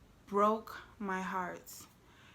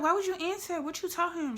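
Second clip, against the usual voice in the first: alarmed; 9 semitones higher, 5 dB louder than usual. Retold or imitated. Shocked at mom answering.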